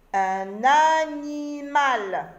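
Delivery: un animal étiré syllabe par syllabe, plus fort; détache chaque syllabe de animal, lentement; très lent, syllabé. Shown as a demonstration. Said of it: "mot découpé lentement". (dire « un animal » en syllabes lentes)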